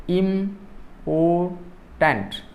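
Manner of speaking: "important" very slowly as im, por, tant, with gaps; syllable by syllable. Shown as a demonstration. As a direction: break "important" into three separate beats. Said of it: "three syllables".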